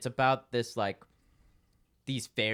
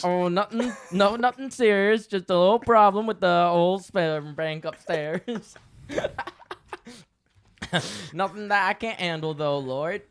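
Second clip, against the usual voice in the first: put-on Southern accent; 4 semitones higher, 5 dB louder than usual. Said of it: "that was our best accents".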